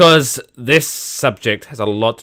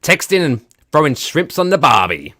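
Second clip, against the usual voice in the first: mock Australian accent; 6 semitones higher, 5 dB louder than usual. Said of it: "passes for entertainment in Australia".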